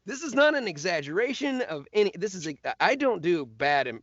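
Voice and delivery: usual speaking voice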